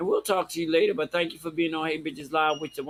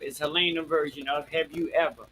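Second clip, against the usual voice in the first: ordinary talk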